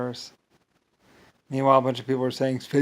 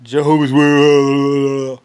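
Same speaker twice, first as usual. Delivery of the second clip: very loud, long wobbling holler; loud drawn-out outburst; 11 dB louder, much slower than usual. Acted. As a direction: blare it out and stretch the last word. Breaks out mid-rant, drawn out loudly.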